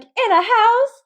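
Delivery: high, loud, drawn-out proud answer; proud child. Retold or imitated. Child answering mom's rhetorical question, loud naive pride. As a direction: blurt it slowly at full volume, very pleased. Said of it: "I said it so proudly".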